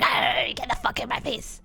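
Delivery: comic growl of annoyance, held back; mock-furious muttered outburst; exasperated snarl. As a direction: growl it out, fed up. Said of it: "And I'm like".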